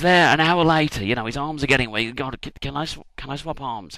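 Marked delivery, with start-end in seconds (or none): 2.1-4.0 meek request. Retold or imitated. Moses meekly asks God.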